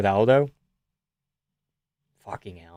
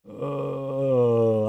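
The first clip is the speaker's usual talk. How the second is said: usual speaking voice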